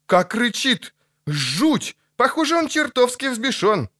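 high, agitated cry; high-pitched horrified outburst; appalled exclamation. Acted. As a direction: marvel in a high, frightened voice. Lutik appalled by the raging djinn.

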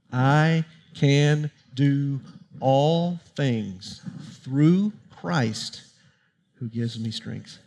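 very slow, word-by-word verse recitation; measured, spaced-out scripture recital; leading group recitation. Real, not acted. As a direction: recite it slowly so a crowd can follow, softening at the end.

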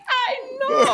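high, loud, laughing agreement; re-enacted thrilled agreement; shared excitement. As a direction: squeal it back in delight. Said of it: "he was so excited".

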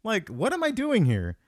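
high, squeaky mock disbelief; baffled what. Recalling his baffled reaction in the theater, mock disbelief. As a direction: play up the confusion. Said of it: "made me go".

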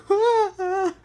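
high, loud mock sobbing gasps; imitated sobs. Imitating herself crying in front of her mom, high sobs. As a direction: gasp out two little sobs. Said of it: "'Cause I was like".

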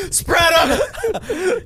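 high, loud laughing command; laughing shout. Pretend. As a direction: yell it through laughter. Mock waxer command, laughing shout.

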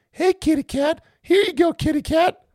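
high, coaxing cat-call voice; calling a kitty. Pretend. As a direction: sweet-talk a cat down off a wire.

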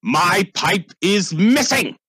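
high, slow angry shout; outraged yell. Acted. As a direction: announce the loss in a fury. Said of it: "your father yelling".

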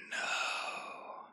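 dry, raspy whispered creature voice; ancient dusty rasp. Acted. Creature rasping at the boy, ancient dusty rasp. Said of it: "it rasped in a voice as ancient and dusty".

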